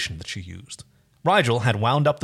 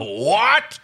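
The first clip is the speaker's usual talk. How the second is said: loud, higher outraged roar; 7 semitones higher, 4 dB louder than usual. A narrator's reading. Dargo hears the toy mock him, a raised roar of outrage. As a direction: roar it in disbelief. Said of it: "roared Dargo".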